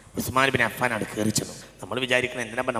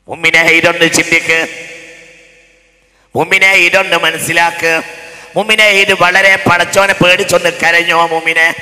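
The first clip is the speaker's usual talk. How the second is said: pleading, forceful sermon; impassioned Malayalam preaching; preaching at full force; 5 semitones higher, 13 dB louder than usual. Real, not acted. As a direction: urge the faithful to weep for God. Urgent appeal to believers.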